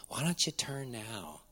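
ordinary talk, nothing marked

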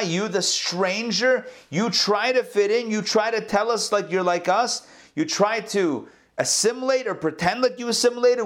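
high-pitched indignant mob voice; acted accusation.

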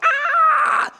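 a sudden, very high, loud shriek of fright; terrified scream. Retold or imitated. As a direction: shriek as if someone just jumped out at you. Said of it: "like, shock and horror. It's like".

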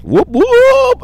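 high whooping hype call; hype cheer. Acted.

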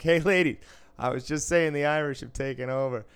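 loud, high retold hail; calling out. Dealer teasing the Irish ladies at roulette, loud call. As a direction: call it out to a rowdy table.

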